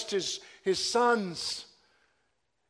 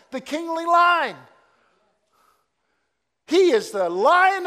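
loud, high preaching build; full-force preaching; 6 semitones higher, 9 dB louder than usual. Real, not acted. Preacher calling out to the congregation, loud and high. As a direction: cry it out from the pulpit.

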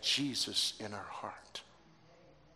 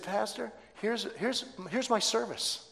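high-pitched, eager announcement; retold joy; 8 semitones higher than usual. Dying woman brings pastor her funeral plan, joyful. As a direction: present it with eager delight. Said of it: "Because she had such joy".

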